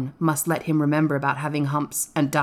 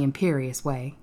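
usual speaking voice

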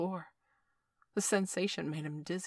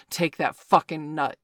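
much louder forceful shout; 12 dB louder than usual. A narrator's reading. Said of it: "Victor grunted".

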